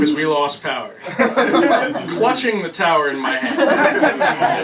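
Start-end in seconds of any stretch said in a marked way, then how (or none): 1.0-2.0 high burst of laughter; bright laugh at own joke. Real, not acted. 2.1-4.6 laughing. Real, not acted. Acting out clutching the tower.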